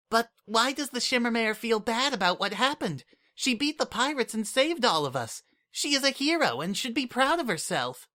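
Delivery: put-on high, earnest childlike voice, confused; a small child puzzled why her hero feels bad; little changeling Kukri. Little changeling Kukri to Sunset, confused and earnest, high voice.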